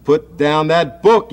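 high, loud, slow yell; big brother yells to make him drop it. A narrator's reading. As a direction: brother yelling at little Sam. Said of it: "yelled George".

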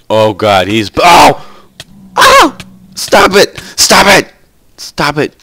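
panicked high yells; shaky stammering panic. Real, not acted. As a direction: scream at them to stop. Attacked by mobs, panicking.

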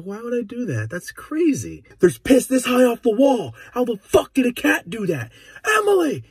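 put-on high, loud kid's protest, building to a yell; mock innocent outrage. Boy denying peeing on the wall, high loud mock outrage. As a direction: protest way too hard. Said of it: "I looked at him and go".

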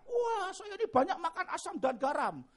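high, loud show-off brag; mock boasting. Preacher mimicking people proud of their hard-won experience, boastful. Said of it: "Asam dan garam kok dibanggakan".

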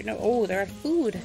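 ordinary talk

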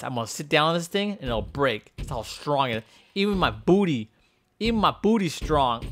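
ordinary talk

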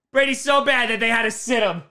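ordinary talk, nothing marked

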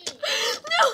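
high laughing no. Pretend. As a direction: laugh through the protest. Laughing while begging.

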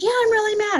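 very high, loud mock outburst; angry insistence. Resident insisting to the caregiver, angry. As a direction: blurt it worked up and forceful. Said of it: "her anxiety and anger".

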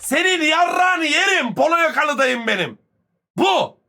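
loud, high, slow crude yell; shouting at the screen. Real, not acted. Streamer yelling at the man in the clip, loud and high.

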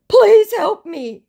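slow, loud, high mock plea; hammed-up cry for help; begging scammer. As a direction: beg in a drawn-out squeal.

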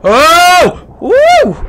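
sudden high, loud yelps of shock; startled shriek. Real, not acted. Gamer nearly killed by a zombie, shrieking in shock. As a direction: yelp like you just dodged death. Near death in zombie game.

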